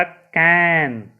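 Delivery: कैन stretched very slowly, the ae vowel drawn out; slow sounding out. Shown as a demonstration. Teacher slowly modelling CAN for the pupils, drawn out. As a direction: draw out कैन syllable by syllable. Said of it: "क्या हो गया".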